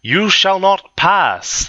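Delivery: slow, high, hammed-up yell; pathetic yell. A narrator's reading. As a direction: yell it drawn out in a silly high voice. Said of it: "the bitch yelled pathetically".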